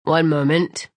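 low-pitched, brief officer's reply; policeman voice. A narrator's reading. Policeman answers the apprentice.

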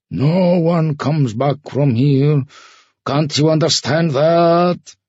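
slow, high, loud harangue. Acted. Ferryman warning Sasha nobody returns, loud and menacing. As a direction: hammer it out, stretching the words. Ferryman overpowers the protesting girl.

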